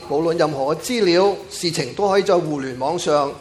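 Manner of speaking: normal talking voice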